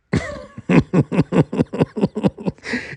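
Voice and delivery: high, helpless giggling laugh; real laughing fit. Real, not acted. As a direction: crack up at your own story. Cracks up at own story.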